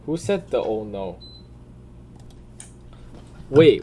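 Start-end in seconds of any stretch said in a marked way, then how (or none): none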